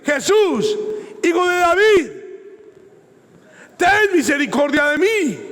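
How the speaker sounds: clamor agudo y fuerte, pidiendo misericordia; grito de súplica. Retold or imitated. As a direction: grita como el ciego que ruega a Jesús. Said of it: "está gritando". (el ciego clama a Jesús, gritando fuerte y agudo)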